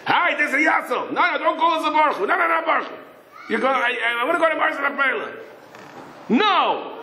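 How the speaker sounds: high, fast, shouted phone call; calling the taxi at night, shouting eagerly. Acted. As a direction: yell into the phone.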